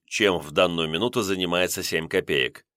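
ordinary delivery

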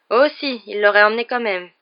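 high, loud defiant reply. A narrator's reading. Ron contradicts Lockhart, loud and defiant. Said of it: "encore plus fort que Dean".

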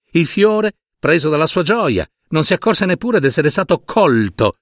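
ordinary delivery, nothing marked

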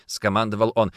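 usual speaking voice

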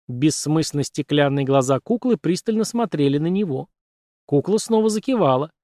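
ordinary delivery, nothing marked

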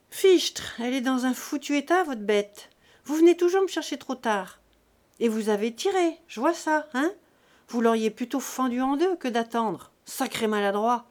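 a vet scolding the farmers, loud; gruff scolding. Acted. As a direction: bark the reproach at the clumsy farmers. Vet scolding farmers over the cow.

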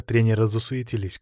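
ordinary delivery

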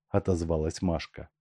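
plain reading voice

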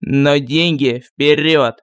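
put-on low, slow, grumbling old sorcerer; lightly voiced village sorcerer; gruff sorcerer voice. A narrator's reading. (ворчливый голос колдуна)

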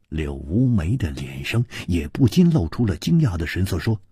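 normal talking voice

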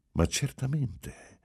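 high, slow, scornfully astonished; drawn-out sneering surprise; insulting amazement. A narrator's reading. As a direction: feign disbelief that he'd even ask. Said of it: "con un suo ingiurioso stupore".

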